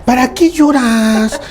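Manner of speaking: high, loud, slow "No llores", mock-scolding; rough Ecatepec mom. Parent scolding a crying child, Ecatepec style, loud and high. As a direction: drag out "¿Para qué lloras?" like a tough barrio mother. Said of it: "Así como en Ecatepec".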